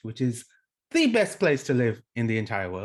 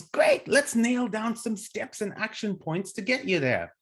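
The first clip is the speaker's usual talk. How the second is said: mock eager coach, much higher; upbeat coach voice; 9 semitones higher than usual. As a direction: push the client forward, chirpy. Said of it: "And then the coach goes".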